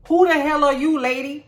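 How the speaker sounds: loud and high, sassy challenge; snapping at the lady with mock outrage; mock confrontation. Quoting a character's confrontation.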